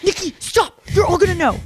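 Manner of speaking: high, pleading mock voice; imitating Hala. Imagining friend Hala listening, high pleading imitation. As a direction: beg Nikki to stop in a squeaky put-on voice. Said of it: "she's going".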